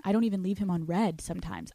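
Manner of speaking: usual speaking voice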